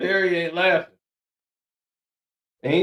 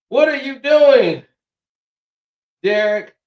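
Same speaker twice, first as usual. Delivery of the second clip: loud, high call across the house; 9 semitones higher, 8 dB louder than usual. Retold or imitated. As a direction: shout his name to get his attention. Voicing someone calling out to Derek.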